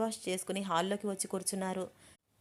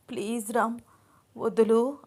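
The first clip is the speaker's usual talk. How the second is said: higher, faltering, pleading to be let go; flustered plea; 4 semitones higher, 4 dB louder than usual. A narrator's reading. Bride held tight by husband, stammering plea. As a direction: gulp and beg him to release her. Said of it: "అంటోంది తడబడుతూ".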